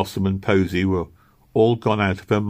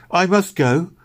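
high, louder urgent plea; urgent pleading; 11 semitones higher, 4 dB louder than usual. A narrator's reading. Meg pleads with Missus Christie, urgent pleading. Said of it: "pleaded Meg urgently".

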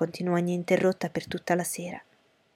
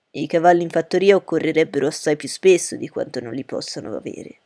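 louder, put-on husband's voice, falling away; dry father figure; 3 semitones lower, 5 dB louder than usual. Husband answering about the farm horses, in a dry father's voice. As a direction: answer as the father, with a firm start.

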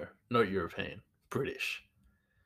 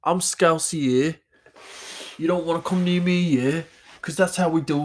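high, loud Liverpool Scouser; hammed-up Liverpool accent; Scouse accent; 8 semitones higher, 7 dB louder than usual. Shown as a demonstration. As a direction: play a cocky Scouser, pitched up and forceful.

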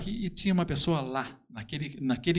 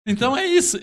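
laughing; 12 semitones higher, 8 dB louder, faster than usual. Real, not acted. Laugh then high wrap-up.

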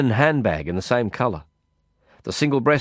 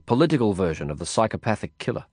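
ordinary delivery

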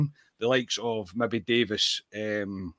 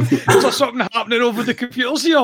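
much higher, louder, through real laughter; laughing; 9 dB louder than usual. Real, not acted. Host laughing at co-host's coughing fit, laughing. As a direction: crack up mid-question.

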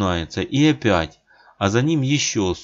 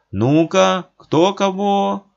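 понукая; 11 semitones higher, 5 dB louder, slower than usual. A narrator's reading. Толпа понукает.

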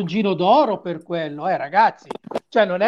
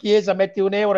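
usual speaking voice

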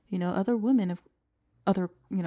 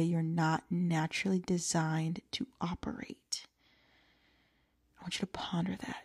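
whisper; 2 semitones lower, 11 dB quieter than usual. Real, not acted. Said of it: "whispered emphasis to listeners".

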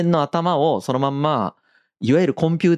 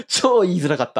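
laughing; 6 semitones higher than usual. Real, not acted. Laughing embarrassed confession repeated.

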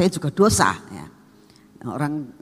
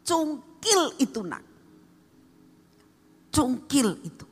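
God's command; 8 semitones higher than usual. Retold or imitated.

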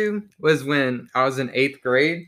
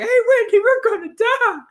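panicked yell; 5 dB louder than usual. Retold or imitated. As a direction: scream it like you're about to die. Panicking on speeding tube.

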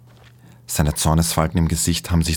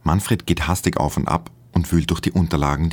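ordinary delivery, nothing marked

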